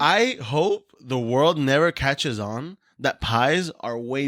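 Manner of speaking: very slow, weighty pronouncement; slow solemn declaration. Acted. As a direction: announce it slowly like a decree. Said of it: "these are facts".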